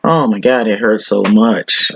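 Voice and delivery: normal talking voice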